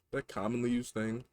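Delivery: ordinary talk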